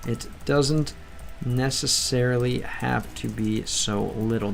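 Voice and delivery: slow, quiet, careful retake; slow soft repeat. Shown as a demonstration. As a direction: say it again slowly and softly, every word separate. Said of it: "it doesn't, it doesn't ne".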